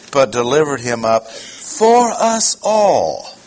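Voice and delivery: pause, then slowly hammer out "for us all"; stress on for us all. Shown as a demonstration. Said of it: "double underline means emphasis".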